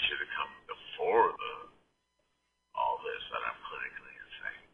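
whisper. Real, not acted. Joking aside after the song.

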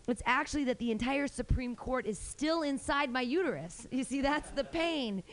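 normal talking voice

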